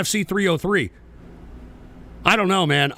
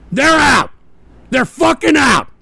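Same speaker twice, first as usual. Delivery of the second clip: loud, higher firm shout; emphatic shout; 4 semitones higher, 5 dB louder than usual. Real, not acted. Warning chat drama-makers they're banned, with a shout. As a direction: slam down the rule.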